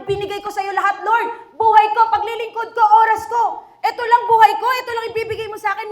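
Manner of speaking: high, loud, fast complaining; mock complaint. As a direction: whine at God like a resentful servant. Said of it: "Hindi mo pwedeng sabihin". (mataas at malakas na pagrereklamo)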